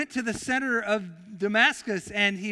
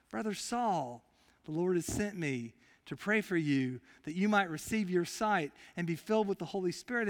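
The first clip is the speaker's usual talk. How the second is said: "Brother Saul" said much softer, almost hushed; soft tender prayer; 4 semitones lower, 7 dB quieter than usual. Acted. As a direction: Ananias tenderly prays over blind Saul. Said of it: "That is so generous".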